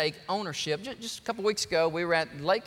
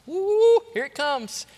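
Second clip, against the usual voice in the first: excited whoop; 11 semitones higher, 7 dB louder, slower than usual. Real, not acted. Building to the climax.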